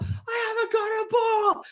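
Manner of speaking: high, drawn-out squeal; mock shouting. Teammate still shouting about the ball, mock squeal. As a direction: cut in with a stretched comic wail. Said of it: "That person's shouting".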